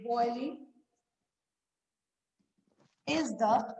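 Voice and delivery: soft dictation with very long pauses; slow dictation. Real, not acted. Teacher dictating to pupils as they write, slow and chunked. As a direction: say it softly, a word or two at a time.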